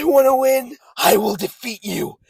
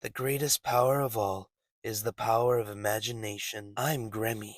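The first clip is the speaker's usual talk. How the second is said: low, very quiet villain voice; soft, calm menace; 11 semitones lower, 14 dB quieter than usual. Acted. Visionary villain telling his foe he will kill him, soft calm menace. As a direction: threaten softly, barely raising the voice.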